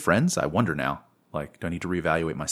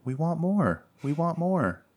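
put-on very high little girl whine; 10 semitones higher, slower than usual. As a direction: chant it like a small child. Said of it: "like that little girl on that A T and T commercial".

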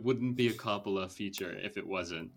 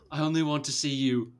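imitating a British man, pitched up; British accent; 4 semitones higher, 3 dB louder, faster than usual. Retelling an actor's line to the interviewer, in a British accent. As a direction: put on a British accent for the quote. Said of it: "I'm doing the British accent".

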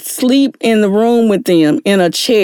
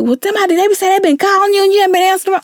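higher, quicker concerned neighbour voice; worried visitors; 10 semitones higher, much faster than usual. Retold or imitated. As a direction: rush it like a friend checking up.

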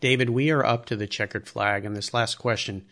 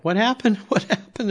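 high-pitched, retold cry of dismay; dismayed disbelief; 10 semitones higher than usual. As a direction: act out baffled disappointment, pitched well up. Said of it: "I'm like".

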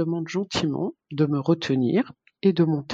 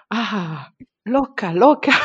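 high, loud mock yell; retold shout; 5 semitones higher, 8 dB louder, slower than usual. Nurse shouting at the mother on the bed, loud mock yell. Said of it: "et elle se met à crier".

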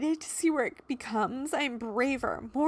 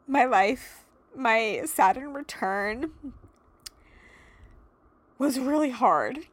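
emotional; 3 semitones higher, 4 dB louder than usual. Real, not acted. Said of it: "So I'm a little bit of emotional right now".

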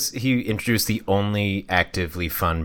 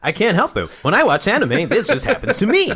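high, bouncy cartoon anime voice; exaggerated anime character delivery, way up high; 10 semitones higher than usual. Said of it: "[name], why are you talking like that?".

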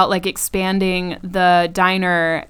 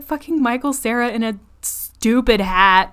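ordinary delivery

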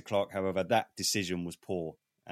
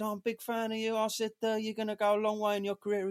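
much higher, eager fan gushing; starstruck fan; 12 semitones higher than usual. Retold or imitated. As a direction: gush at him like a starstruck fan.

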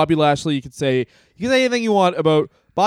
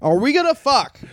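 high mock question; 9 semitones higher than usual. Repeats the mock reply higher.

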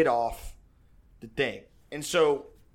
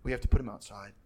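stoic quiet; 4 semitones lower, 7 dB quieter, faster than usual. Retold or imitated. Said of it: "she's like".

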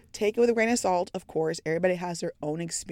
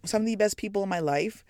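ordinary talk, nothing marked

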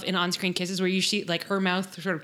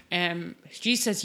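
ordinary talk

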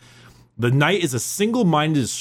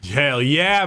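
ordinary talk

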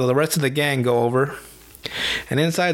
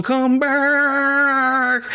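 much higher, louder, drawn-out echoing come back; echoing call; 11 semitones higher, 3 dB louder than usual. Retold or imitated. The hermit's last echoing call after the gang, much higher and louder. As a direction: call it like it echoes off the hills. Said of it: "the echo on the last one".

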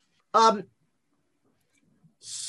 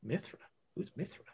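quiet in-character aside; puzzled aside; 18 dB quieter, faster than usual. Acted. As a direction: ask it under your breath, much softer. Apollo puzzled by unknown god, aside.

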